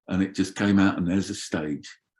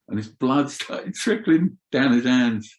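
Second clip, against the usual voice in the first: normal talking voice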